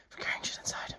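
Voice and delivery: breathless whispered aside. Real, not acted. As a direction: whisper it while catching your breath.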